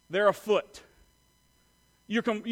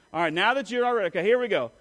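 normal talking voice

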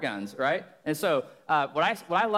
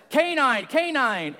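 radio call; 9 semitones higher, 6 dB louder, much slower than usual. Retold or imitated.

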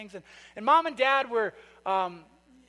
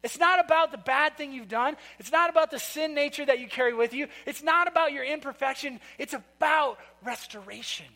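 louder, higher, building rhetorical preaching; fervent sermon climax; preaching at full force; 4 semitones higher, 4 dB louder than usual. Acted. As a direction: drive the point home to the congregation.

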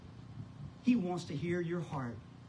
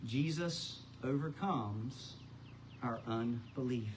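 slow emphasis; 4 semitones lower, 4 dB quieter, slower than usual. Shown as a demonstration. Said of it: "repeated for emphasis".

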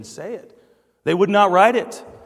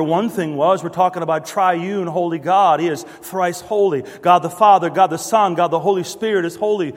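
usual speaking voice

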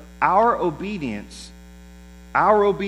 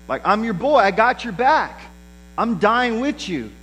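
higher, louder voice of a devoted friend; eager loyal pledge; 7 semitones higher, 4 dB louder than usual. Acted. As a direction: pledge it with hammed-up bravado. Voicing Peter's eager pledge of loyalty.

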